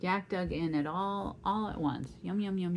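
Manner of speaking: ordinary delivery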